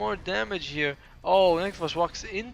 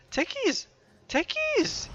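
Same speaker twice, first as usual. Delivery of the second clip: Techies yelled much higher, each time higher; exasperated yelling; much slower than usual. Real, not acted. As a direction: shout Techies in rising disbelief. Caster calls out missed kill.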